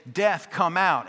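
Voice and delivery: loud, high call at the church door; booming call. Retold or imitated. Pastor calling at the locked door, loud booming call. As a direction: pound out the call to death. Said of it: "He would say".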